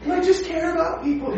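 high, slow, self-pitying wail; mock a meddler playing the victim; a gossip whining that everyone hates her; whiny meddler. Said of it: "She's like".

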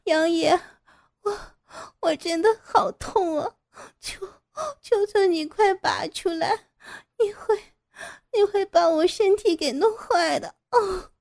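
high, sobbing desperate begging; crying plea. Acted. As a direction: beg through tears, voice high and strained. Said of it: "哭泣着开口求饶". (哭着高声哀求)

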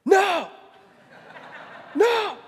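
put-on high, loud toddler scream of "No!"; toddler yelling no. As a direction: shriek it like a scared toddler. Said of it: "and she would go".

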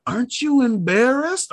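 high, incredulous comedian's catchphrase; Sebastian Maniscalco. Retold or imitated. Quoting the meme at dog owners, high incredulous delivery. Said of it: "Maniscalco meme here".

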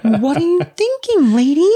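high-pitched incredulous scolding, half laughing; mock exasperation. Mock-scolding a film victim.

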